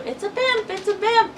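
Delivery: gleeful high-pitched blimp cry; excited high squeal. Retold or imitated. [name] recalling childhood blimp sightings, imitated high squeal. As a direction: squeal it way up high, like a delighted kid. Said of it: "she would always go".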